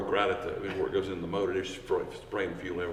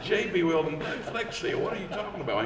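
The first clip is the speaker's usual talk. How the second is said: baffled, pitched-up retort; incredulous; 8 semitones higher than usual. Retold or imitated. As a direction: question it like it's absurd. Incredulous retort to a repair idea.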